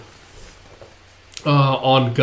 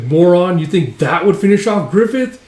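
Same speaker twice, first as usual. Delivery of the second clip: high, raised voice; acted scornful retort; 5 semitones higher, 5 dB louder than usual. Follower berates comrade who doubted the leader, scornful shout. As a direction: scold a doubter loudly.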